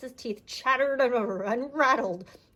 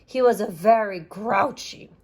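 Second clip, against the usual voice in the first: ordinary delivery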